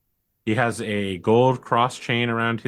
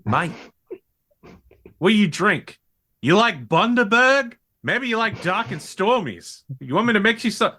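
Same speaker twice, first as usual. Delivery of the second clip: hammed-up bogan host; 7 semitones higher than usual. Bogan sergeant offering drinks to the intruder, hammed-up high. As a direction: lay on the Aussie mate act, pitched up. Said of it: "he's like".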